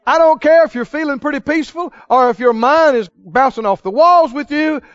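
normal talking voice

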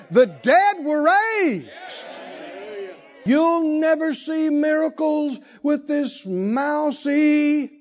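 high, drawn-out, rising sermon cadence; full-force preaching. Real, not acted. Preacher rouses congregation about miracles, fervent and high-pitched. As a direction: stretch each phrase out high to stir the room.

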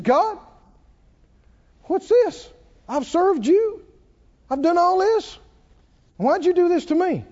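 high-pitched indignant protest to God; mad. Retold or imitated. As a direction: demand an answer, acting out outrage. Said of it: "And then he got mad".

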